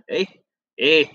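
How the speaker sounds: trying out a Canadian "eh"; short questioning Canadian "eh". Shown as a demonstration. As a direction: practise the Canadian tag "eh". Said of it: "it would be like that".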